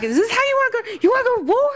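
high furious yell at an enemy; berserk rage. Pretend. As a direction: screech a threat of war. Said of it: "I was like".

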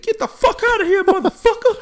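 high, loud mock shout of a street brawler; angry old man yelling. Old men arguing on street.

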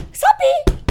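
very high excited calling out. Real, not acted. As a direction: call her name with excitement.

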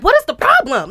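retold snap at a crying child; exasperated parent. Mother retelling her reaction to his crying, exasperated.

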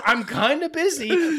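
high, slow, sing-song "I'm kinda busy"; mock her dismissive reply, drawn out. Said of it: "She just goes".

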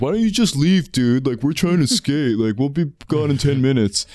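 mock the bratty skater kid; hammed-up obnoxious skater voice; snotty skater. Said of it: "doing that stupid thing".

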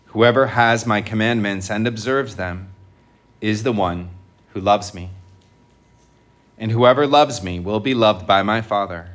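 measured, solemn liturgical reading; gospel proclamation. Real, not acted.

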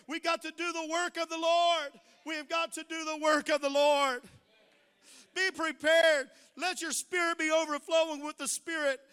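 loud, high preacher's cry; preaching at full force. Acted. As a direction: lift the church with a ringing shout. Preaching exhortation.

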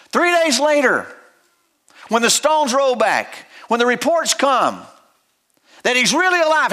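high-pitched, impassioned sermon delivery; fervent preaching. Acted. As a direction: preach it to the congregation. Preaching with rising fervor.

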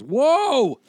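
loud, high cry of alarm; alarmed whoa. Retold or imitated. Agent halting client's haircut plan, alarmed. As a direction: stop them dead with a big whoa.

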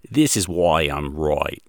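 put-on slow, light Australian accent, right as roy. Host imitates Ken Ham's closing line in a mild Australian accent. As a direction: drag it out in a mild Aussie voice. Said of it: "That's an awesome Australian accent there".

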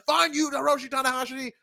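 high-pitched escalating retort; mock outburst. Wrestler giving in after being goaded, mock-heated. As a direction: blurt it out as a mock-heated comeback. Acting out the escalated argument's climax.

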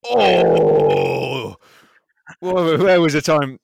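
loud, high oh and whoa; surprised shout. Real, not acted. Host watching a skate video, shouting in surprise. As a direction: burst out as a big trick lands. Startled by a trick.

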